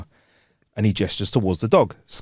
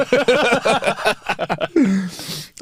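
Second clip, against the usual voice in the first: laugh; 8 semitones higher than usual. Real, not acted. Laughing at riddle.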